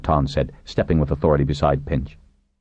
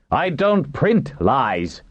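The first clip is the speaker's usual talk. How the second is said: slow, high indignant denial; crying out loudly; 10 semitones higher, much slower than usual. A narrator's reading. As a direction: deny it emphatically, drawn out and pitched up. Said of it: "Tagatarl cried loudly".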